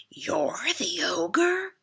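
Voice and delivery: loud, very slow jeer; jeering goat. A narrator's reading. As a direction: sneer it down at the little ogre. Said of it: "he jeered".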